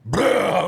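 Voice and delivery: loud, high mock spew; spewing noise. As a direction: imitate someone spitting up water. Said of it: "water comes out of his mouth".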